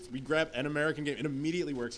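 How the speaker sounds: ordinary delivery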